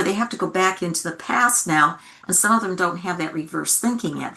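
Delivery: usual speaking voice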